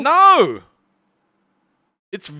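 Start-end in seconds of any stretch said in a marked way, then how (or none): 0.0-0.6 loud, high-pitched no; a loud, emphatic refusal. Real, not acted. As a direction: blurt out a big no. Emphatic answer to cash question.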